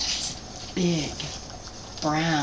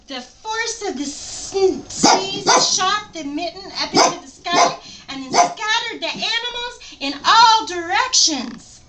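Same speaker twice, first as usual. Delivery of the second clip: excited story narration pitched up after the sneeze; lively narration, high; 11 semitones higher, 4 dB louder than usual. A narrator's reading. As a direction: tell the burst with wide-eyed energy. Reading picture book aloud.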